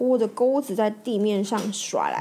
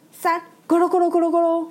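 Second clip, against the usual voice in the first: fast, higher tumbling sound effect; rolling-back noise; 7 semitones higher, 4 dB louder, faster than usual. Acted. Narrator voicing Bob's truck righted, rolling noise. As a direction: make the truck roll back upright.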